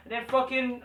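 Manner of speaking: normal talking voice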